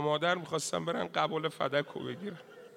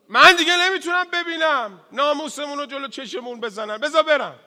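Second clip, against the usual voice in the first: loud, high cry begging to go; anguished plea; 10 semitones higher, 9 dB louder than usual. Acted. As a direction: cry out that you can't bear it.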